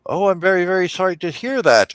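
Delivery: high, concerned gnome voice; sympathetic gnome. Acted. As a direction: sound sorry for him.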